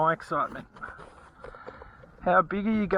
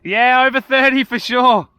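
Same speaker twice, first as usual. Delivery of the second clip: much louder, called out across the water; 5 semitones higher, 13 dB louder than usual. Real, not acted. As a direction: shout it out proudly. Calling out a fish size.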